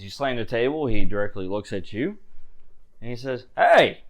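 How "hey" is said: high, loud bark of a warning; sharp warning shout. Acted. Bartender warning a rough customer, a loud sharp hey. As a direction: snap at them to watch the table.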